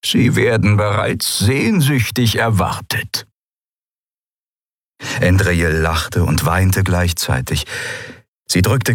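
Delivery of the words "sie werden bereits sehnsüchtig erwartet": put-on old man's smiling welcome. A narrator's reading. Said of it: "Der alte Mann lächelte".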